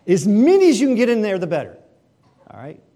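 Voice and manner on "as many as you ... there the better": greedy glee. Acted. Kid gobbling whole bag of candy.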